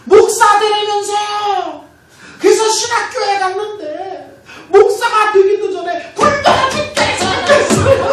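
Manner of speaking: mock wailing prayer shouted high, words stretched out; 울부짖는 기도. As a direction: cry out to God in a drawn-out, hammed-up high yell. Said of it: "소리 지르면서 기도했어요". (과장되게 길게 끌며 높이 울부짖는 기도 흉내)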